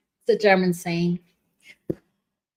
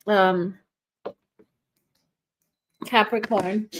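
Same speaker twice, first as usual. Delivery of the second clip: normal talking voice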